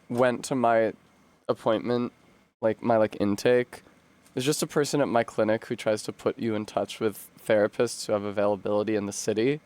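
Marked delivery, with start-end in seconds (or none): none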